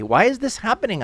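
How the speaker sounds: high, hurried, bewildered question; distressed plea. Acted. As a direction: plead for an explanation, fast and high. Resident protests being evicted.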